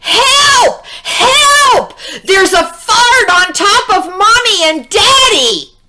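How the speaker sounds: put-on child yelling to police on the phone; shouting for help. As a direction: yell into the phone in alarm. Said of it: "She said".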